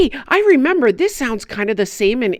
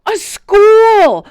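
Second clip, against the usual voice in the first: eager answer; 11 semitones higher, 7 dB louder than usual. Acted. Puppet finishes Rosie's sentence eagerly.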